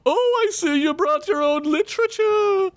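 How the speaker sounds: very high, cheeky doorman voice; high squeaky little man. Acted. Peephole gnome taunts Boris.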